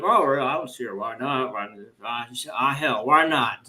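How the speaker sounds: put-on a light Southern accent, easygoing and unhurried; a hint of a Southern accent, loose and folksy; southern drawl. Imitating Clinton answering Putin, light Southern drawl. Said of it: "with his southern drawl".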